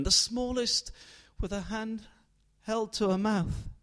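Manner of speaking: ordinary delivery, nothing marked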